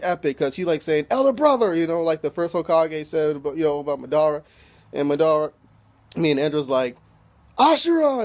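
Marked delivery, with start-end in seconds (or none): 1.1-1.7 high, hammed-up anime cry; imitating a dramatic battle call; mock dramatic call. Acting out an epic anime cry. 7.6-8.2 hammed-up dramatic shout, pitch leaping up; mock battle yell. Imitating Indra calling his brother.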